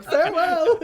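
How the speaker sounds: high, loud, sing-out goodbye; mock cheery farewell. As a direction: call it out with laughter in the voice. Shopkeeper cheerily waves them off.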